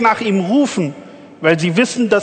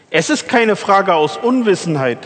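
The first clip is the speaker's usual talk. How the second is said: ordinary delivery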